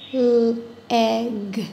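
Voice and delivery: Leg stretched as a long lll, a pause, then egg; phonics-style Leg, split after a held L; slow, drawn out. Shown as a demonstration. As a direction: draw out Leg slowly, holding the L apart. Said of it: "Let me say it again slowly".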